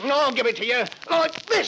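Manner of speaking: Henry stabbing his wife; furious shout. Acted. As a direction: strike out in rage.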